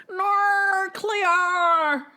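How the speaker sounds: high, loud mock Australian accent. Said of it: "how they try and do an Australian accent".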